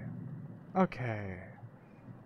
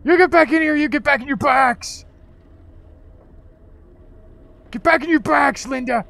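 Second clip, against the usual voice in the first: loud high yell at Linda; shouting; 9 semitones higher, 8 dB louder than usual. Real, not acted. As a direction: yell it out in outrage. Yelling at game character.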